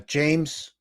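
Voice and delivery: ordinary talk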